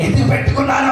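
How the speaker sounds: high-pitched rueful self-questioning; regretful enemy. Acted. Preacher voicing the opponent who must repent, mock regret. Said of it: "అనుకోవాలి ఆడు".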